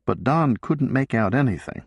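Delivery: ordinary delivery, nothing marked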